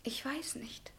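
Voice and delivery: unvoiced, very soft; whisper. [name] whispering to the professor, at a loss. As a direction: breathe it back.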